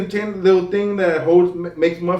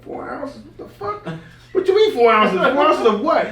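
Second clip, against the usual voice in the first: incredulous; 7 semitones higher than usual. Retold or imitated. Acted shock at seller's answer.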